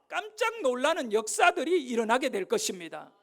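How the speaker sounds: loud, high, impassioned sermon delivery; full-force preaching. Acted. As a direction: preach it out loud to the whole church. (힘차게 외치는 설교조)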